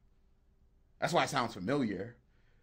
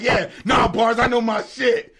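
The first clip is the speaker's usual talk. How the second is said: higher, louder hyped-up shout; amped shout; 8 semitones higher, 9 dB louder than usual. Retold or imitated. Friend imitated hyping up the beat, amped shout. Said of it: "Sean getting amped over there".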